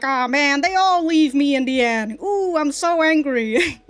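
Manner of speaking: put-on angry complaining; mock anger. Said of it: "resort to anger".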